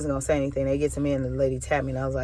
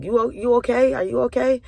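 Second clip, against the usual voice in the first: higher-pitched imitation of a concerned lady; retold worried question, pitched up; imitated concern; 8 semitones higher than usual. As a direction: check on someone in a raised, anxious lilt. Imitating a concerned lady checking on her.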